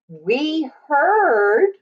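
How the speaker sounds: usual speaking voice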